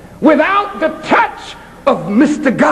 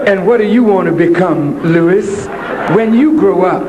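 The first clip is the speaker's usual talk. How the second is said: teacher voice; 7 semitones lower than usual. Retold or imitated.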